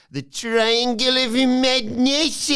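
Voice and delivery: high, loud, drawn-out proclamation; theatrical mock-ominous announcement; hammed-up announcer voice. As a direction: proclaim the name slowly, over the top. Announces faction name in put-on voice.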